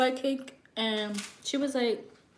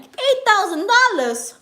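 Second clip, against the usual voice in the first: much higher, louder, slow outraged disbelief; shocked disbelief; 10 semitones higher, 10 dB louder than usual. Retold or imitated. Mocking the psychic's price to viewers, outraged disbelief. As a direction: stretch it out in mock outrage.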